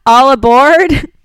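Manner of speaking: loud, drawn-out boarding call; interpretive script read. Shown as a demonstration. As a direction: call it out slowly to the passengers. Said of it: "I love that you have this written in".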